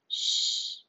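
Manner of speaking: shushing. A narrator's reading. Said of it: "I shushed".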